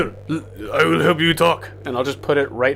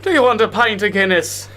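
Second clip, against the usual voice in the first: jokey Irish pub voice, higher and louder; mock Irish brogue, played for laughs; Irish accent; 7 semitones higher, 6 dB louder than usual. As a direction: offer the pint like a hammed-up Irish orc. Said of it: "talking with an Irish accent".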